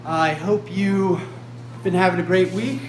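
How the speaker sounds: put-on loud, chirpy children's TV host; overbright kids party host. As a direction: beam at the kids, loud and eager. Kids show host greeting.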